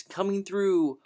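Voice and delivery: loud, slow, clear call. A narrator's reading. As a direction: call ahead to clear the hall. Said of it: "yelling in a loud, clear voice".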